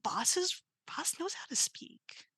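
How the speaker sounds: hushed unvoiced confiding; whisper. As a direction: whisper it like a secret.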